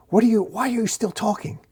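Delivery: exasperated. A narrator's reading. Dork exasperated at talking shield.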